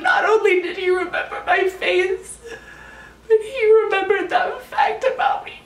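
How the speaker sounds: real tearful voice, pitched high; tearfully overwhelmed. Real, not acted. Fan amazed he remembered her, really crying. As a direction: fight through happy tears as the voice rises. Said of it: "time to dry my tears".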